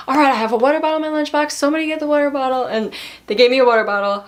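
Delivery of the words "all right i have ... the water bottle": high, fast, louder manager taking charge; manager's voice. Retold or imitated. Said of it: "My manager was like".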